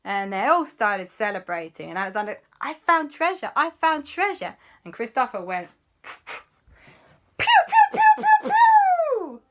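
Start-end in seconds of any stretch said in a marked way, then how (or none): 2.6-4.6 high, celebratory treasure cry; excited cheer. Acted. Alexander announces his find to everyone, excited. 6.0-9.4 very high imitated laser shots; laser zaps. Christopher fires his laser fingers, squeaky zapping.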